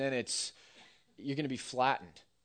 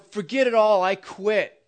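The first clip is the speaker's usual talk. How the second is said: loud, higher blow-up; mock angry outburst; 6 semitones higher, 11 dB louder than usual. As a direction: throw it down and storm off. Acted quarrel, storming off.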